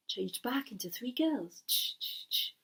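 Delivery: usual speaking voice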